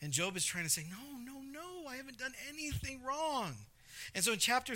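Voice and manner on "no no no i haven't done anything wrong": high, soft pleading voice; mock protest. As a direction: protest softly in a high imitated voice. Voices Job protesting to his friends.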